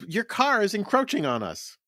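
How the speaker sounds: mock New York accent. Pedestrians complaining to a driver, mock New York accent. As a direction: scold a driver in a put-on accent. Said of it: "what you say up there".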